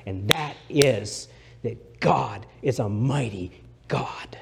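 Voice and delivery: whispered, slow and intimate; hushed whisper. Acted. Preacher whispering to the congregation, hushed and slow. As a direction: lean in and breathe the words slowly.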